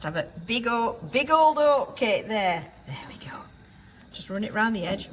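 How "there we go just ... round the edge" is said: soft whisper while working. Real, not acted. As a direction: whisper it under your breath. Quiet focus while cooking.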